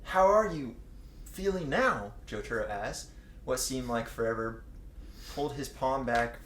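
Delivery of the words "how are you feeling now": ordinary delivery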